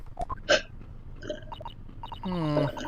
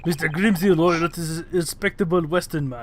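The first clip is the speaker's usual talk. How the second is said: put-on Russian accent. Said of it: "just do Stroganoff voice".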